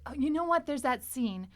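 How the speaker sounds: usual speaking voice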